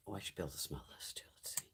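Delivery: very soft, quick whisper; whispered aside. Real, not acted. Checking whether the lip product smells, whispered to self.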